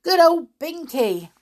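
high, loud cheering; excited yell. Acted. Winks cheering while pumping Binky's hand. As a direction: cheer his friend.

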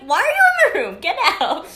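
loud angry yell; shouting. Retold or imitated. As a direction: snap at him to get out. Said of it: "And I'm like".

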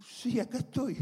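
high, small voice answering a summons; acted-out sheepish response; meek reply. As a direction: answer timidly, as if singled out by soldiers. Retold timid answer to armed soldiers.

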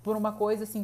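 ordinary delivery

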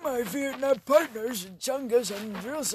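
put-on gruff old war buddy voice; slow, like a grizzled old soldier; old veteran voice. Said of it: "Sound like a, a British old guy".

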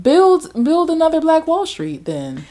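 high-pitched mocking challenge; sarcastic taunt.

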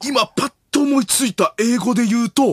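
high, feigned sudden inspiration; 伊集院光. Acted. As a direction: announce a supposed flash of insight. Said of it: "伊集院光さんが". (ひらめいたふりの伊集院ものまね)

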